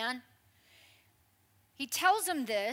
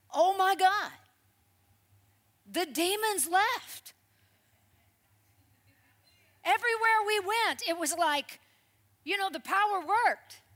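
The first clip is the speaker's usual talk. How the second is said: higher and louder, bursting with excitement; excited disciples; 6 semitones higher, 7 dB louder than usual. Retold or imitated. Disciples reporting to Jesus, thrilled and loud. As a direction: gush like thrilled disciples back from a mission. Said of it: "They come back all excited".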